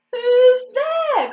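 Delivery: high, loud singsong call. A narrator's reading. Swan to whoever is in the reeds, calling. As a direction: call out to someone hidden. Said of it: "called the swan".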